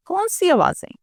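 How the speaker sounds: put-on innocent child denial. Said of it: "تو آگے سے کہتی ہے".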